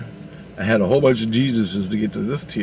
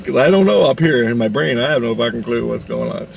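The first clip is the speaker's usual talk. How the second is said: ordinary delivery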